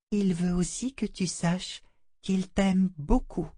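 high, soft doll voice, gentle; a doll consoling the child; tender doll voice. A narrator's reading. La poupée tendre. (voix de poupée tendre)

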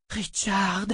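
soft, high tearful call; breathed through tears. A narrator's reading. Shota in tears appeals to Richard, softly. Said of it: "souffla Shota, en larmes elle aussi".

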